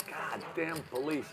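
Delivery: grumbling. Acted. Old driver grumbles on spotting police.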